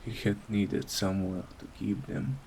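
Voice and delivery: ordinary delivery, nothing marked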